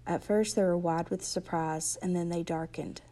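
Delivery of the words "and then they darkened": plain reading voice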